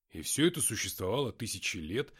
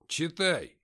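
calm order; 4 semitones higher, 6 dB louder than usual. A narrator's reading. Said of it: "спокойно сказал я".

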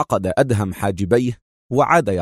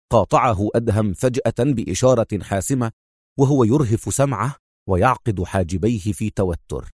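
ordinary delivery

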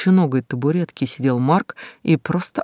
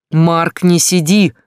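shouting; 3 semitones higher, 6 dB louder, slower than usual. Acted. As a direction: yell at him to move. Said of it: "закричал он". (кричит на Марка)

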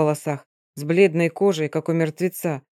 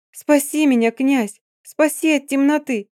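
high, louder frightened begging; desperate plea; 8 semitones higher, 3 dB louder than usual. A narrator's reading. Lina crying to her prince to save her, terrified. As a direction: cry out for rescue from the dark. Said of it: "в голосе прозвучали слёзы и мольба".

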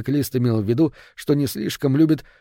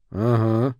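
ordinary delivery, nothing marked

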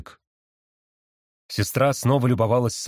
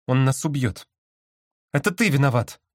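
higher accusing voice; sister voice; 4 semitones higher than usual. A narrator's reading. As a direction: blame him in the sister's voice. Sister blames brother.

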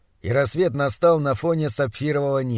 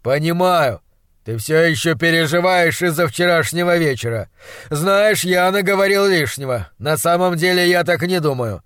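placating monstrologist; 3 semitones higher, 4 dB louder than usual. Acted. Doctor making up for last night's words, placating. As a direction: win the boy back with emphatic praise.

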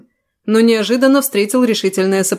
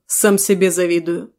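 usual speaking voice

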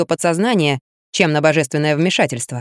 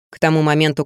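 usual speaking voice

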